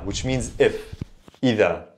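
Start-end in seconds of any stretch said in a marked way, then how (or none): 1.4-1.9 careful Fusha "Ida", d said as th; original MSA pronunciation. Shown as a demonstration. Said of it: "The right pronunciation is indeed Ida".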